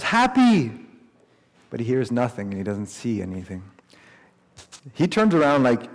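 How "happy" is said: high, loud call for a lost dog; yelling. Retold or imitated. Dave calling down for his fallen dog, yelling. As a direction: yell it out as if calling down a cliff. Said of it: "And he yells".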